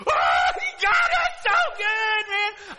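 high, drawn-out yell of amazed delight; ecstatic shout. Retold or imitated. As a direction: re-enact losing it with excitement, full voice. Retelling his excited reaction aloud.

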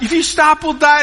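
retold warning with a touch of panic; urgent warning. Dad urging kids on the river to keep paddling, mock urgent.

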